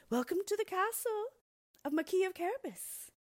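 high, loud ceremonial welcome; calling out. A narrator's reading. Puss greets the arriving king, high loud call. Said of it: "Puss called".

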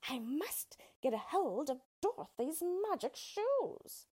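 high, slow witch voice; drawn-out, high-pitched witch muttering to herself; scheming witch. A narrator's reading. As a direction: plot greedily to yourself as a witch. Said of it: "the witch said to herself".

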